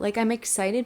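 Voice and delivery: usual speaking voice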